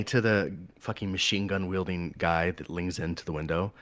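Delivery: normal talking voice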